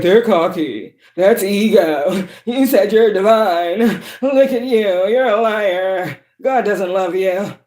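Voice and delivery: slow, drawn-out mocking voice; mock accuser. Reader mimicking critics who accuse her, slow and mocking. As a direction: stretch each accusation out in a put-on whine.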